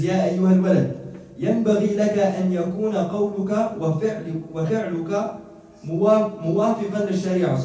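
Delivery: quick, loud recitation of the Arabic text; recited Arabic quote. Acted. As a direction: rattle off the classical passage with force. Reads Arabic text aloud.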